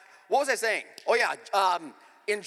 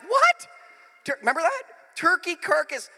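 high, loud disbelieving cry; comic retold outrage; incredulous shock; 8 semitones higher, 6 dB louder than usual. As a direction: blurt it out aghast, pitched way up. Retelling shocked reaction to soup plan.

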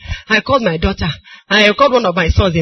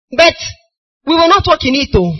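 higher-pitched mock defiance; acted defiant refusal; 7 semitones higher than usual. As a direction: refuse outright, pitched up, as a stubborn crowd. People's refusal to God, acted.

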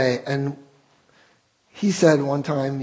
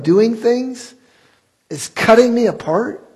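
higher, louder, slowing on key words; impassioned preaching; 3 semitones higher, 3 dB louder than usual. Acted. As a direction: plead it from the pulpit. Preaching the lawyer's realization.

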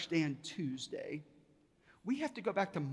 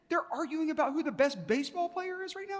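very high-pitched disbelieving question; incredulous echo; 10 semitones higher than usual. Retold or imitated. Visitor echoing guide's answer about best player, incredulous. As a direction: repeat it back as an astonished question, pitched way up.

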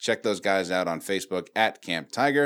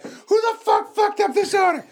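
high, loud furious boss yell; angry boss; 5 dB louder than usual. Pretend. Pretend manager yells at workers, angry. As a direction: yell at the staff over a botched order. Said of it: "You start feeling bad".

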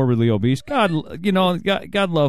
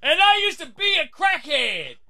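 testifying preacher; slower than usual. Retold or imitated. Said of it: "who's like".

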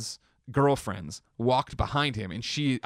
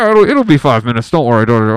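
much louder reassuring tech; scan tech; 14 dB louder than usual. Retold or imitated. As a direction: call it out breezily across the room.

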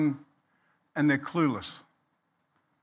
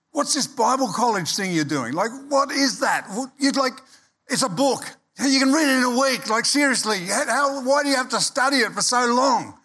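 mocking mates; 6 semitones higher than usual. Mates mocking his Bible college.

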